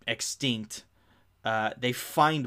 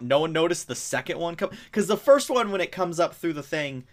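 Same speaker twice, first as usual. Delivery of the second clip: usual speaking voice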